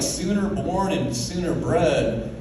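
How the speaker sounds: normal talking voice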